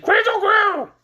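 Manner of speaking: high, loud glass cat; the glass cat joining in, high and loud. Acted. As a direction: squeal it like a cat. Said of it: "glass cat said".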